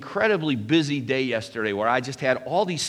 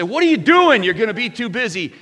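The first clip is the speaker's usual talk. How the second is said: much higher and louder, an imitated wife's sudden yelp; wife's mock outburst; 12 semitones higher, 12 dB louder than usual. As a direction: play the startled wife, half-joking. Wife's outburst, retold by husband.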